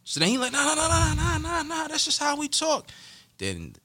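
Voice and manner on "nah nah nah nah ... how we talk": much higher, hammed-up placating voice. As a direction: play the guy backing off, pleading that it's just talk. Retold guy calming him down.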